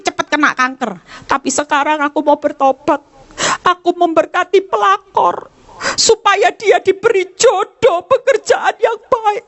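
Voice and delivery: lightly voiced high praying woman; a touch of a churchgoing wife at prayer; mock prayer of a wife. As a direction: play a repentant wife praying, half mocking.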